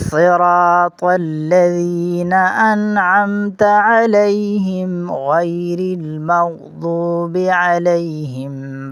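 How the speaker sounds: slow melodic tajweed recitation; Quran recitation. Shown as a demonstration. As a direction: recite the verse slowly, drawing out each word. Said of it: "ایک بار مکمل آیت پڑھتے ہیں". (آہستہ تجوید کے ساتھ قرآنی تلاوت)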